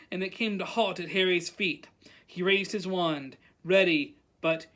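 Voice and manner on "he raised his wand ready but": usual speaking voice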